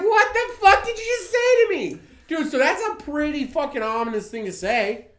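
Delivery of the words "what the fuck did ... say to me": high, loud, incredulous comeback; mock outrage. Interviewer answering a veiled threat, mock outrage. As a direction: act affronted, pitch shooting way up.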